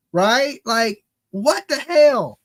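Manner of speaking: ordinary talk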